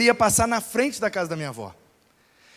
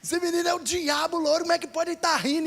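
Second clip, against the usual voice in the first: put-on high-pitched angry scolding of a laughing kid; angry jailer; 8 semitones higher, 3 dB quieter than usual. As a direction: bark at the laughing boy, outraged.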